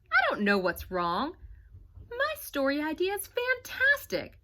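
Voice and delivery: put-on a kid fed up with his story; frustrated boy.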